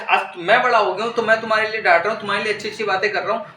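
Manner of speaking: ordinary talk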